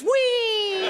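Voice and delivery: high drawn-out whoop; gleeful whee. Retold or imitated. Old man riding the trolley, high long whee. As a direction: sail along with a happy cry. Old man's noise imitated.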